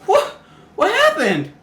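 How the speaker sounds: high, slow, stunned asking; like just pulled out of the water, confused; dazed surprise. Retold or imitated. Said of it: "I'm like".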